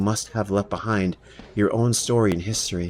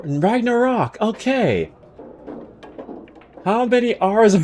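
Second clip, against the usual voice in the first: normal talking voice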